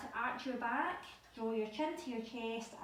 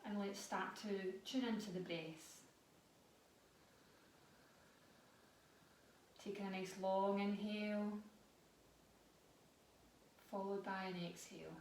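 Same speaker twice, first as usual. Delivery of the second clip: guided breathing; 6 dB quieter than usual. Acted. Leads a guided breathing practice.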